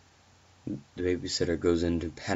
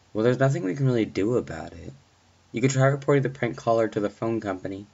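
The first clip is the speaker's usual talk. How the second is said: brisk, higher-pitched police dispatcher; dismissive dispatcher; 5 semitones higher, 4 dB louder, faster than usual. Acted. Dispatcher brushing off a babysitter's prank-call report, brisk and dismissive. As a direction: rattle it off like an unconcerned dispatcher.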